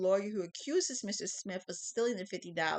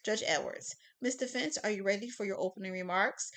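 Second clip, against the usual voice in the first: normal talking voice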